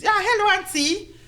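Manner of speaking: high-pitched mock reply; imitating someone recoiling while saying hello; imitated young man's greeting. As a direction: put on a startled, high greeting. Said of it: "And he does".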